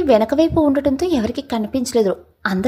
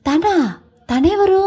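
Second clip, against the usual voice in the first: alarmed; 6 semitones higher, slower than usual. A narrator's reading. Sakshi hears of another woman, alarmed and higher. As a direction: jolt up in panicked disbelief. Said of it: "అంది కంగారు పడుతూ".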